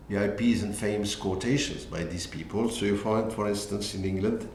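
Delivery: usual speaking voice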